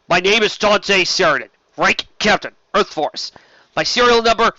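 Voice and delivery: loud, clipped name-rank recitation; defiant captain. Acted. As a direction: stand tall and recite it. Captured Sheridan gives name.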